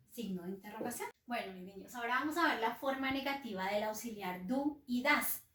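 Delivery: ordinary delivery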